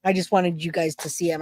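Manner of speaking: ordinary delivery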